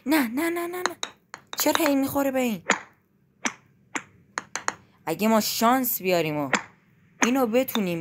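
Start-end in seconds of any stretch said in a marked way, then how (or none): 0.1-2.6 panicked protest. Real, not acted. Character keeps hitting obstacle.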